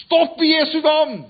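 full-voiced high shout, slowly; shouting stop. Monk shouting at the arena crowd to stop. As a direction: cry out to halt the fight. Preacher repeats the monk's cry.

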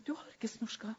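soft serious whisper; husband whispering quietly; whispered reply. Retold or imitated. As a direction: hush it gravely.

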